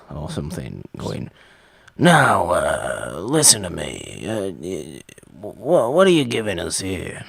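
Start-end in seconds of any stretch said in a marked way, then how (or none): 2.0-7.2 put-on studio boss, loud opening then halting; hammed-up movie boss voice, stumbling for words; cigar-chomping movie exec. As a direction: talk round a cigar like an old-time exec. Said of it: "Movie execs would be sat there with a cigar".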